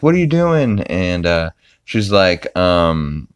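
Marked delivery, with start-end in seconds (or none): 0.0-0.8 indignant whine. Retold or imitated. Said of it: "Peter's like".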